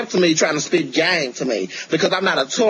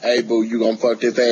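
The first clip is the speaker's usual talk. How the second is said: deep voice; 6 semitones lower than usual. Retold or imitated. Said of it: "he gonna be like".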